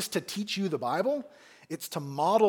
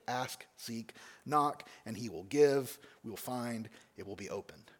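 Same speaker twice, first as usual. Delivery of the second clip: much softer and lower, trailing down; hushed recap; 7 semitones lower, 7 dB quieter than usual. Real, not acted. Preacher recapping the promise to the congregation, hushed. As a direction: let the promise sink in quietly.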